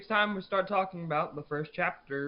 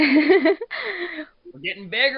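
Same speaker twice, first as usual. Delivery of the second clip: drawn-out, high and loud hype call; excited; 12 semitones higher, 10 dB louder, much slower than usual. Real, not acted. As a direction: stretch it out like a cheer to the fans. Hyping podcast growth to listeners.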